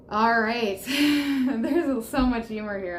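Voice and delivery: ordinary delivery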